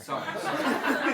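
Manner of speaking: giggly sorry; laughing apology. Real, not acted. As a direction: apologize while cracking up. Laughs after teasing.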